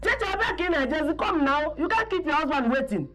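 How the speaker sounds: high, urgent summons; calling out. Acted. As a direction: call the daughter over insistently. Mother summons daughter to suitor.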